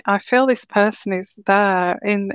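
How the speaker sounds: usual speaking voice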